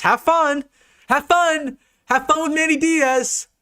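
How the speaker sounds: high, slow, sing-song "have fun"; mocking a rival fan's coaching search; sarcastic taunt. Real, not acted. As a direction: gloat with fake cheer.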